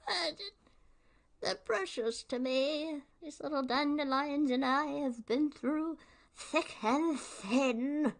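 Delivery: put-on villain voice gone high and fond over his weeds; doting cartoon villain. Question answerer defending his weeds, fond put-on villain voice. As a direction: coo over the dandelions in the villain voice. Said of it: "I love doing that type of voice".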